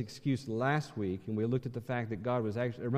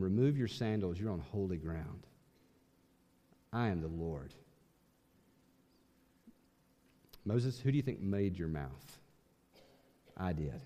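lower, softer, solemn voice from the burning bush; voice of God; 6 semitones lower, 7 dB quieter than usual. Retold or imitated. God from the burning bush to Moses, low and hushed. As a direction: speak as the Lord, hushed and weighty. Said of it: "From the bush".

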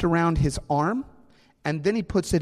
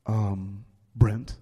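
lower, slow teacher's voice; stern math teacher speaking low to a fifth grader; deep teacher voice; 6 semitones lower than usual. Retold or imitated. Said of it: "he had this very deep voice".

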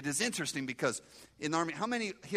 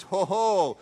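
loud scoffing ho ho; mocking ho ho; 3 semitones higher, 10 dB louder than usual. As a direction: bark out a loud mocking laugh-shout. Scoffing at freedom of religion.

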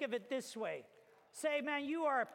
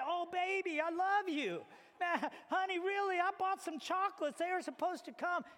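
high, pleading, half laughing, louder; pleading boyfriend; 7 semitones higher, 3 dB louder than usual. Retold or imitated. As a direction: beg her to believe you, voice pushed up high. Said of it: "you're trying to say".

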